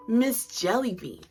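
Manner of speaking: ordinary delivery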